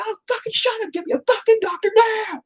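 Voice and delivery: very high, strained screamed order; re-enacted scream. Father cutting off hospital staff, high-pitched scream. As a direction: scream over them, desperate and furious. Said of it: "I screamed at him to get".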